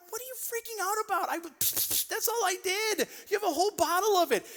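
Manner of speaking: exasperated dad. Retold or imitated. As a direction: scoff at the overreaction. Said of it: "I'm like".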